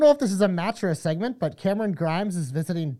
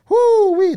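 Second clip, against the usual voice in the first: high, loud "whoo-wee"; loud hyped-up holler; excited whoop; 11 dB louder than usual. Real, not acted. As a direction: let out a high drawn whoop. Whooping over upcoming match quality.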